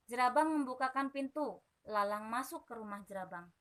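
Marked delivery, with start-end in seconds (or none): none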